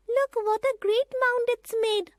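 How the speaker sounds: put-on high, bright child's voice; a girl marvelling at the landslide; young girl Bobby. A narrator's reading. Girl staring at the fallen rock, high and amazed.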